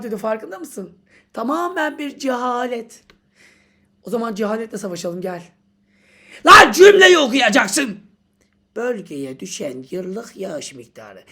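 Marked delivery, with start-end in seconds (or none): 6.5-8.0 much louder yell with a punch on lan; exasperated yell. Acted. Teacher repeating the rule at the student, yelling. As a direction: bark the rule at them.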